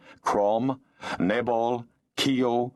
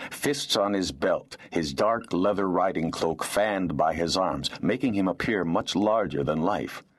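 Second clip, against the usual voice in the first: plain reading voice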